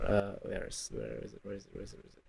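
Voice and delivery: very low, hushed mumble while searching; absent-minded searching murmur; muttering to self. Real, not acted. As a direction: trail away under your breath, almost inaudible. Searching the video, talking to self.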